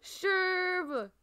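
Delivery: high, loud, drawn-out squeal; excited cheer. Real, not acted. Player celebrating getting Sherb, excited squeal. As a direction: cheer in a piercing happy squeal.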